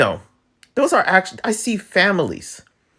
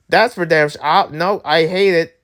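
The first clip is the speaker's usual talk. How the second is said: ordinary talk, nothing marked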